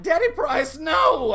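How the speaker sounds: very high, loud protest; panicked no. Retold or imitated. Boy begging grandfather not to use the medicine, panicked high cry. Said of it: "I said".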